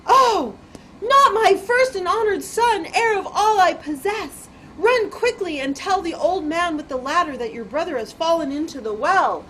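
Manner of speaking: high, loud burst of dismay; alarmed mother. Acted. Mother learns her son fell, alarmed outcry. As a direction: cry out in horror, then order urgently.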